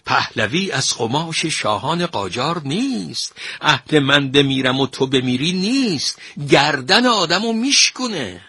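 high-pitched, mounting warning; threatening. A narrator's reading. Minister warns the painter about the Shah, threatening. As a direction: build the threat to its last phrase. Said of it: "تدین وزیر تهدید کرد".

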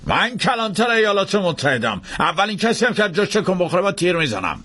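high-pitched declaimed warning; threatening lawman. Acted. Marshal holding the room at gunpoint, shouted threat. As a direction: announce it high, as an armed threat.

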